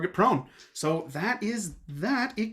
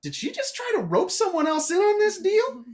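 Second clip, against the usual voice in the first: high, loud disbelief; incredulous Dan; 13 semitones higher, 4 dB louder than usual. Acted. Dan reacts to Harriet's charm, high and incredulous. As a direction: catch her trying to rope someone in. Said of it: "you're like".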